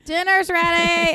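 loud, high, drawn-out call; yelling across the room. Pretend. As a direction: call him in from another room. Calling husband to dinner.

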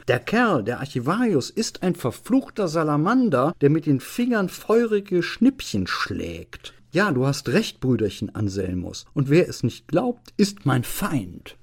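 high, emphatic tipsy outburst; ranting registrar. Acted. Drunken registrar siding with Anselmus against the archivist, ranting. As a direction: rant as the worked-up registrar.

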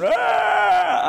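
sudden high yelp of terror; a short, sharp shriek of panic; panicked scream. Retold or imitated. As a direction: cry out like a frightened disciple. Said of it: "I think they just went".

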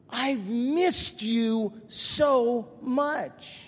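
very slow, drawn out word by word; little girl's heartfelt greeting. Retold or imitated. Little girl hugging the preacher, slow heartfelt greeting. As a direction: stretch every word out for emphasis. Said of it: "and she says".

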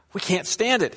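high-pitched, mock-distraught wail of protest; distraught complaint. Returning man complains to rabbi about goat, acted distraught. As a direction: play a man at the end of his rope. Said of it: "looking more distraught than ever".

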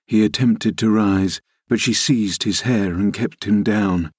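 usual speaking voice

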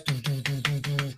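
hushed doo doo heartbeat; heartbeat imitation. Shown as a demonstration. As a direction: tap out a racing pulse with the voice. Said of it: "Heart racing like this".

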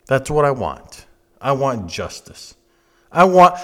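ordinary delivery

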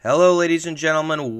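normal talking voice

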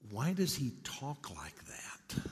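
softly voiced puzzled little girl. Little girl asks her mother about a stranger, lightly voiced child. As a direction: ask slowly and softly, like a child. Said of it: "she looked at her mother and said".

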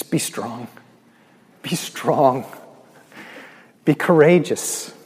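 rising to a full loud preach; preaching scripture. Acted. Preacher proclaiming God's promise to the hurting, building loud.

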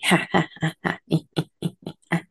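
a wild, unhinged cackle; stumbling stranger's crazed maniac laughter. Acted. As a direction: laugh like a man out of his mind. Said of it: "laughing like a maniac".

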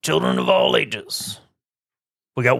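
lightly voiced circus ringmaster; a hint of showman announcing. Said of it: "Weather with Rick".

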